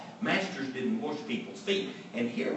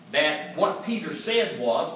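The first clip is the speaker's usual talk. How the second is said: usual speaking voice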